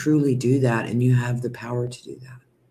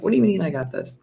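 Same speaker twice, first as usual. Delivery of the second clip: surprised disbelief; 8 semitones higher, faster than usual. Retold or imitated. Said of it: "I was like".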